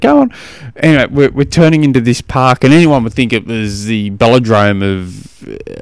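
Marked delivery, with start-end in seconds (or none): 0.0-0.3 high, loud hurry-up shout; urging yell. Retold or imitated. Son yelling at the chasing rider, high and loud. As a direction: spur on the rider. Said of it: "he's yelling at it".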